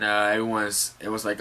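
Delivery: normal talking voice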